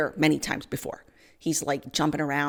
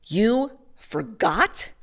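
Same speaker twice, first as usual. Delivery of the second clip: slow, higher, disbelieving "You forgot?" rising at the end; incredulous question; 5 semitones higher, much slower than usual. Retold or imitated. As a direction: drag out "You forgot?" as if you can't believe it. Angry judge repeats her words.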